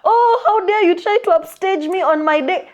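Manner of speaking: high-pitched mock outrage with a laugh; hammed-up indignant scolding; mock mad. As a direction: play an offended birthday girl, amused. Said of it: "she's mad at her homegirl".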